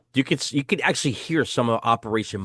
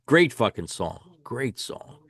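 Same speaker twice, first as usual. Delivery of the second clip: ordinary delivery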